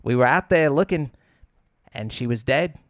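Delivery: imitated Australian witness, endings rising like questions; Australian upspeak. Host imitating a documentary survivor, Australian upspeak. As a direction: sad news with a lilting Australian rise. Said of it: "it's always, like, up".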